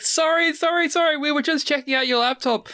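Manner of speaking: high-pitched panicky mock apology; flustered apology. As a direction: blurt apologies like a caught nerd. Acted nerds caught, hastily apologising.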